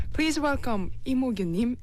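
ordinary talk

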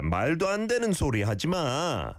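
말도 안 되는 소리 하지 마 run together quickly; no gap between 되는 and 소리; connected phrase. Shown as a demonstration. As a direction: say 말도 안 되는 소리 하지 마 as one flowing unit, pitch swooping up. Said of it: "those have to kind of go together".

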